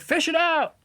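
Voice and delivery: very high urging; mock insistence. Craig daring him to fish, mocked very high. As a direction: squeak the dare. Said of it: "He's like".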